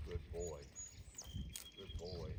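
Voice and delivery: quiet praise to a good horse; gentle praise. Real, not acted. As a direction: murmur it low to settle him. Praising horse after stopping.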